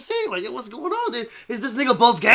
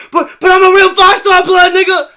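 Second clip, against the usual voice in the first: much louder, higher mock bragging; mock boast; 7 semitones higher, 14 dB louder than usual. Imitating the same man bragging about his gang, loud. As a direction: blast out the fake gangster boast. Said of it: "he talking about".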